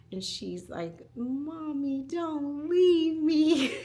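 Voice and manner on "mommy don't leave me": put-on high, slow, whiny little girl begging; imitating crying child. Mother imitates her crying daughter begging her not to leave, high whine. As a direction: mimic a small child pleading through tears. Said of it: "and she starts to cry".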